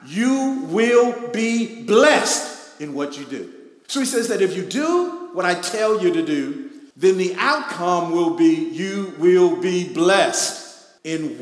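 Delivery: loud, slow preaching build; full-force pulpit delivery. Acted. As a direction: preach it out to the congregation.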